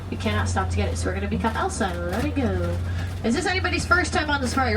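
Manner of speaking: ordinary delivery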